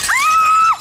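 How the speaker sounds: high sharp shriek; startled scream. Acted. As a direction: scream in sudden fright. Sudden scream at surprise.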